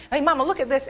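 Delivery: put-on high, bright-eyed kid calling out; interrupting child. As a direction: butt in like an eager child. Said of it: "I'd come back and say".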